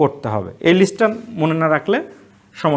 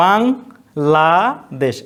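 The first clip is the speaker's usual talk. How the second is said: Bangladesh stretched very slowly, pausing after বাং; slow teaching demo; drawn out syllables; much slower than usual. Shown as a demonstration. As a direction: spell out Bangladesh slowly, one syllable at a time. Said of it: "এক জায়গায় থেমে যাচ্ছি".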